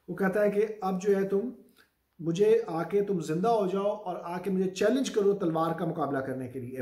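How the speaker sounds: ordinary talk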